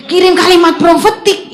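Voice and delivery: loud, high exclamation of disbelief; alarmed outburst. Retold or imitated. Friend told to send the prophetic message, high alarmed outburst. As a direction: blurt it out high and loud, aghast.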